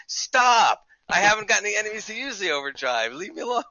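exasperated yell. Retold or imitated. Streamer yelling at the nagging game voice, exasperated. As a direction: beg the game to stop nagging. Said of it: "I'm sitting there during the stream going".

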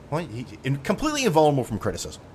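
ordinary talk

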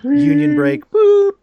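high loud squeal calling a union break; squeaky mock union call. Host calling a mock union break, high and loud. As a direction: blow the whistle with your voice.

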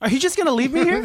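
high laughing protest; half laughing plea. Real, not acted.

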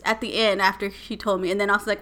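ordinary talk, nothing marked

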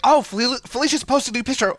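high, eager mock fan voice; light up high, imitating a fan; excited fan. Fan spotting a new picture post, excited.

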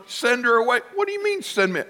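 high-pitched incredulous echo; hammed-up outrage; mock indignation. Outraged reaction to disciples, acted.

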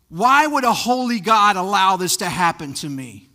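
acted cry of protest, questions piling up; grieving protest. A griever demanding answers from God, loud anguished protest. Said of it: "turn away from God during their moments of grief".

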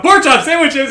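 loud, high comic shout; mock yell. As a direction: burst out yelling through laughter. Acts out a burst-in yell.

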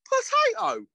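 high, loud announcing of Potato; hammed-up food announcer. TikTok food guy holding up a potato, proclaimed high and loud. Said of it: "he picks up a potato and he's like".

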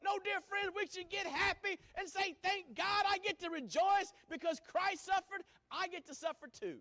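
high, loud joyful cry; excited preaching peak; exultant. Real, not acted. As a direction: shout the joy.

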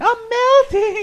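high, slow, loud melting shriek; wicked witch. Acted. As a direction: wail it like the witch dissolving.

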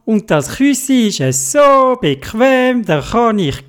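a kitten thrilled with its house; high cheerful meowing cat; happy cat voice. Acted.

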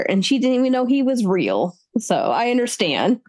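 ordinary talk

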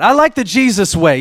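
usual speaking voice

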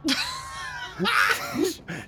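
shrill high scream of terror; girl's scream. Acted. GM voicing the girl screaming at the stranger. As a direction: scream like a terrified child. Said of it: "She screams".

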